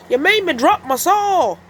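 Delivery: high, loud cross cat voice; cross Mister Cat. Acted. Mister Cat scolds the wolf, high cross voice. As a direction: complain sharply. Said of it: "said Mister Cat".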